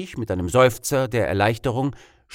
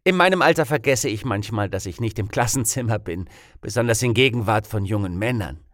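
ordinary delivery

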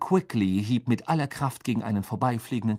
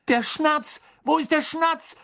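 high-pitched urgent yell; 3 dB louder than usual. A narrator's reading. Charlie searches for the Snitch from nearby seats, frantic yell. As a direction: bellow it frantically across the stands. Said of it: "brüllte ein paar Plätze weiter Charlie".

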